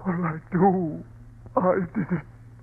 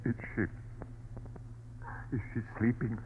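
hushed; 10 semitones lower, 16 dB quieter, much faster than usual. Acted. Husband anxious, whispering.

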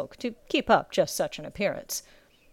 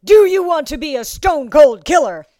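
loud, high drill master bellow; 7 semitones higher, 12 dB louder than usual. A narrator's reading. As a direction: roar the question in his face. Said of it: "such a bellow to come".

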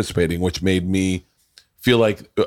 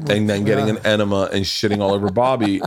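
usual speaking voice

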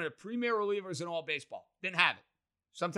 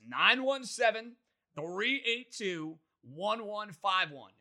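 slow, each digit spaced out clearly; slow repeat of number; 5 semitones higher, much slower than usual. Shown as a demonstration. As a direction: read the number slowly for callers. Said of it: "share how you get in touch".

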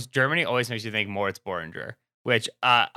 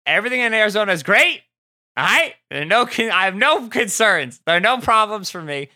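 higher and louder, mock cheerful insistence; sarcastic denial; 9 semitones higher, 4 dB louder than usual. Fan mocks the Cardinals' problems, in sarcastic cheer. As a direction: protest far too much that all is fine.

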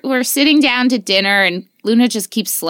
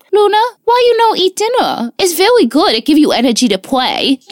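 put-on high, louder little-boy voice coaxing sweetly; toddler voice; 8 semitones higher, 4 dB louder than usual. Mother imitating her son coaxing his sister to eat, high and sweet. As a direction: coax like a tiny boy at dinner. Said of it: "and he goes".

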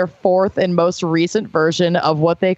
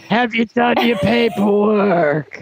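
Roz voice; slower than usual. Acted. I love Roz.

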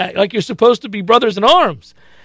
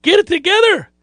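exasperated shout; 10 semitones higher than usual. Pretend. Host scolding the bad official, exasperated shout. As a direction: yell at the bad official to shape up. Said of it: "Yeah, you are".

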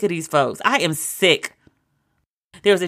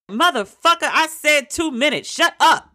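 Samuel L. Jackson impression; 8 semitones higher than usual. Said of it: "they should have Sam Jackson".

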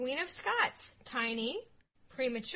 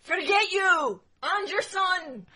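loud, slow, higher-pitched crowd-style yell; mock defiant shout; 5 semitones higher, 10 dB louder, slower than usual. Scots rejecting the English king's proposal, hammed-up defiant shout. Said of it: "all the Scots came together to say".

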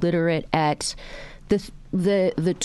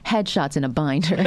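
usual speaking voice

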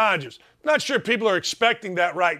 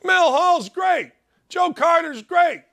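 higher, louder protest in disbelief; indignant fan outcry; 8 semitones higher, 4 dB louder than usual. Retold or imitated. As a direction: cry out like upset fans, voice pitched up. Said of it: "We're like".

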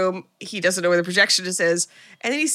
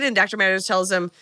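ordinary talk

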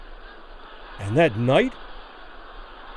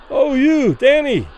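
high, loud cry of admiration; loud cheer; 8 semitones higher, 6 dB louder, slower than usual. A narrator's reading. Admiring fans call to the fighter through the ropes, crying loudly. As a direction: call it out loudly to your hero. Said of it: "cried loudly".

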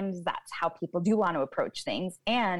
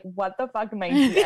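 laughing; 3 semitones higher, 6 dB louder than usual. Retold or imitated. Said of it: "I was like".

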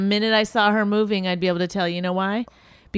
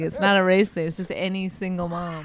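ordinary talk, nothing marked

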